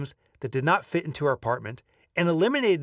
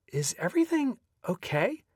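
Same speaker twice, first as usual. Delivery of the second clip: lightly voiced parent, higher pitch; higher, concerned question; 7 semitones higher than usual. A narrator's reading. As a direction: gently check in on a grown child. Parents' concerned question in retold dialogue.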